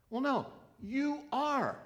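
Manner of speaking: playful insistence, stressing "are"; insisting. Acted. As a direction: press the point home, slow and lifted. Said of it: "Jesus insists to doubter".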